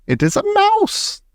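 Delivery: high squeak on mouse; gleeful high squeal; squeaky. Acted. Witch squeaks the climax.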